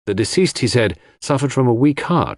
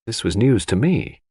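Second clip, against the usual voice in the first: ordinary delivery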